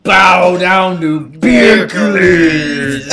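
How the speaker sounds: slow, loud sobbing proclamation; crying shout. Retold or imitated. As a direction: wail the command through tears. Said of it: "That's what he says".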